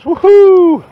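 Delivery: high, gleeful victory whoop; excited whoop. Real, not acted. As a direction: cheer like you just struck gold. Celebrates fifty cents found.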